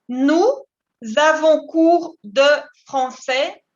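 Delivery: slow model answer. Shown as a demonstration. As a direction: lay the answer out for students. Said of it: "Et vous dites".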